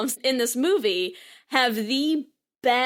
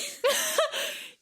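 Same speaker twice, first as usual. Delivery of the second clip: a high, airy burst of laughter; high breathy laugh; 5 dB quieter than usual. Real, not acted. Laughing at her own Carol comparison.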